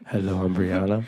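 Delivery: low mock introduction; teasing low-pitched copy; mimicking the guest.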